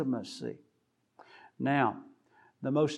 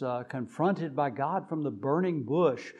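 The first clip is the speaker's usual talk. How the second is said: usual speaking voice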